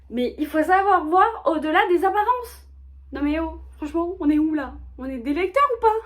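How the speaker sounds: high, loud mock-outraged plea; mock indignation. Mock outrage defending a favourite character.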